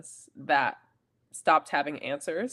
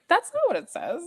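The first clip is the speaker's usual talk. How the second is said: retold indignant disbelief; 13 semitones higher, 4 dB louder than usual. Retold reaction to her pastor.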